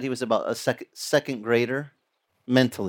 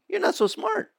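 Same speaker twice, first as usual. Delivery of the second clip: mocking jab. Mocking the grudge-holder.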